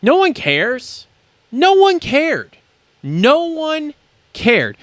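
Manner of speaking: high, slow, drawn-out words; exasperated insistence. Real, not acted. Host telling Mathis off in a rant, exasperated. Emphatic repetition in angry rant.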